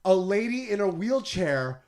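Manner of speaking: slow loud exaggerated dismay; mock horror. Telling friend about Disney crowds, slow mock-horrified. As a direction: drag it out in loud fake shock. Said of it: "And I'm like".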